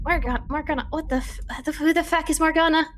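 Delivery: high, stammering disbelief; confused high-pitched outburst; bewildered Doros. Acted. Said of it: "And Doris is like".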